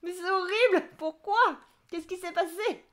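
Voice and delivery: high-pitched, horrified exclamation; shocked reaction. Real, not acted. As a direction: react aghast and demand to know what happened. Reacts to near-death escalator anecdote. (exclamation horrifiée, voix aiguë)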